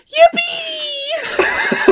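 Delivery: high squealing cheer; excited kid yippee. Retold or imitated. Kid voice cheering over Cola.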